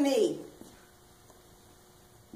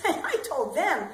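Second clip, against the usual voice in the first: normal talking voice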